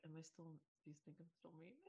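very soft retold worry; timid question. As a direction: ask it almost inaudibly, afraid of the answer. Retells scared question to a friend.

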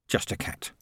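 whisper. A narrator's reading. Morris reduced to a cat.

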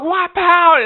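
short, high loud holler; retold yelling. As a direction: imitate a man yelling from behind. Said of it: "before he stopped yelling".

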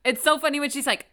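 ordinary talk

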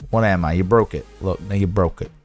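normal talking voice

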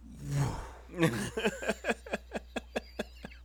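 long vocal sound effect of scraping; imitated scraping whoosh. Storyteller imitates the bike scraping, drawn-out sound effect. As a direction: mimic a long, sputtering scrape. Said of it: "That was a beautiful sound".

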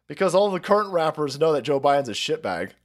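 ordinary talk, nothing marked

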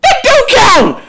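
high, drawn-out laughing shout; real shouted objection. Real, not acted. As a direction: shout it back in loud, laughing disbelief. Loud objection to his friend.

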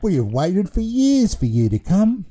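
put-on a cheerful baby in charge of a school; baby headmaster voice.

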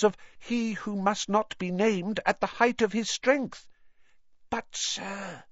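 high squeaky elf voice, full of awe; Dobby, reverent and earnest. Acted. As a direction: praise him devoutly as a tiny elf.

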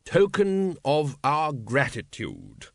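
slow, drawn-out aristocratic ruler; suave patrician. Acted. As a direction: drawl it like a bored, polished tyrant. Patrician's voice in narration.

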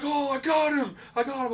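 high, loud imitated cry of victory; mock triumphant shout. Friend thinks he shot a monster, high mock triumphant cry. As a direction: crow it in a high voice.

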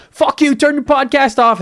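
very high, loud mock-grandma yell; hammed-up screeching old lady; angry grandma. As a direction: holler it like a cartoon granny from the other room. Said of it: "Oh yeah, grandma".

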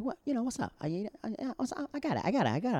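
high, excited imitation of her; imitating his friend. Friend answering his praise after her set, imitated high. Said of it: "And she's like".

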